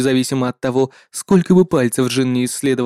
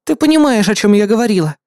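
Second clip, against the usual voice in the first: high character voice; 7 semitones higher, 4 dB louder than usual. Acted.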